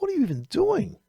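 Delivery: very high-pitched, exaggerated disbelief; mock incredulity. Trader reacting to her leverage complaint, mock incredulous. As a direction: pitch it way up as a baffled question.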